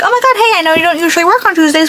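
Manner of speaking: high, eager mock gush; mock gushing coworker. Imitating how the coworker should have texted, mock gushing. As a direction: play the overly sweet coworker. Mimics coworker's likely text.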